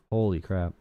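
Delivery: ordinary talk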